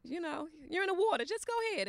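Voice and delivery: high, coaxing sing-song; coaxing grandma. Retold or imitated. Grandma coaxing grandson in the water, high and coaxing. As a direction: gently urge a little boy. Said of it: "I'm like".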